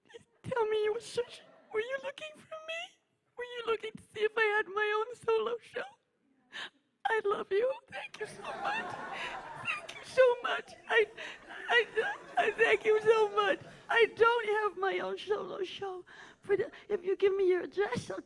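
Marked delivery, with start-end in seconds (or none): 0.1-10.7 high, quavering fake sob of a needy diva; mock tearful pleading. Diva begging a fan in the audience, mock tearful and high. 10.9-16.0 mock tearful thanks. Diva sobbing thanks.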